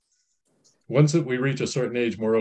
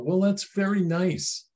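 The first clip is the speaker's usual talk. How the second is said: slow, higher-pitched placating tone; mock polite approval; 8 semitones higher, slower than usual. Parent answering a child's French literature plan, mock polite approval. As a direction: humour them with drawn-out, higher politeness.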